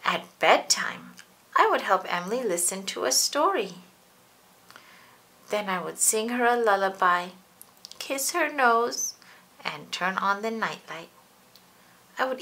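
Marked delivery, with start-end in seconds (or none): none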